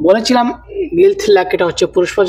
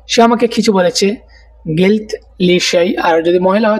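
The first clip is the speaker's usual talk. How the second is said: ordinary talk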